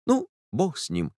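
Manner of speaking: put-on soft, faltering words of a jilted girl; breaking voice. A narrator's reading. Heartbroken girl to the narrator, voice breaking. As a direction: let the voice catch and break. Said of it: "проговорила она наконец прерывающимся голосом".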